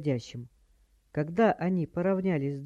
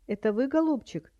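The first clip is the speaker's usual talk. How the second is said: high-pitched doddery granny; high, fussy old-lady voice; batty old woman; 8 semitones higher than usual. A narrator's reading. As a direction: stall sweetly as a dotty old lady. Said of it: "прекрасно изобразила полоумную старуху".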